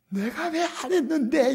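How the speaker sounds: high, loud, drawn-out mock indignation; angry voice. Pastor mimics angry person denying anger, mock angry voice. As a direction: imitate someone angrily denying their anger. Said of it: "화난 목소리고".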